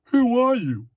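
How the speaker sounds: very high, loud, hammed-up challenge; stock TV character. Shown as a demonstration. Showing how a TV lawman speaks, high and hammed-up. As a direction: demand it like an over-the-top screen cop. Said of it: "a character that speaks like this".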